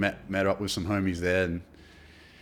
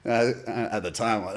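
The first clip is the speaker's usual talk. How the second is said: ordinary talk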